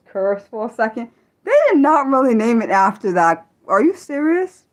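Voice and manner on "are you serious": ordinary talk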